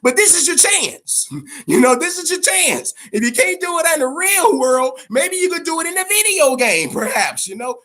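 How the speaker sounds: high, loud, egging on a gamer; mock pep talk. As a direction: hype him up like a buddy shouting at the screen. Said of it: "I'm like".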